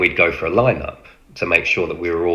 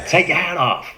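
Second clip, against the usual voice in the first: higher American command; American accent; 11 semitones higher than usual. Retold or imitated. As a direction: bark it in the officer's American accent. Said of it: "he stayed in his American voice".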